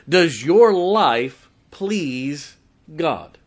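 loud, high, very slow preaching; full-force preaching. Acted. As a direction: hammer each word from the pulpit.

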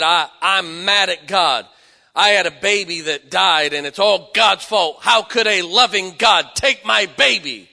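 loud, bitter shout at God; furious grieving mother; angry yelling. Retold or imitated. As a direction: throw the grief at God in anger.

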